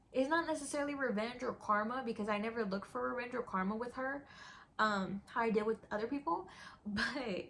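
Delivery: ordinary delivery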